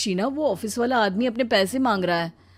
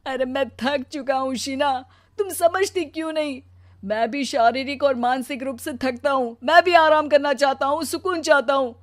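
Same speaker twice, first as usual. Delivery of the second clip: hurt, worn-out husband, voice rising in bursts; anguished protest; 6 semitones higher, 6 dB louder than usual. Acted. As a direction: plead to be understood, bursting out loud. टूटा पति पत्नी से फ़रियाद करता. (आहत होकर भरी आवाज़ में फ़रियाद)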